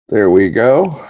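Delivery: usual speaking voice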